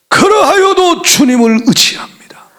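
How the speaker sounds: fervent declaration. Acted.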